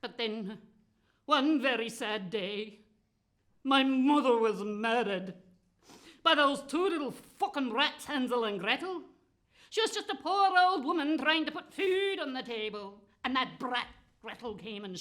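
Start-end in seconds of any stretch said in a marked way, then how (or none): 1.2-11.4 dramatic lament. Acted. Dramatic telling of mother's murder.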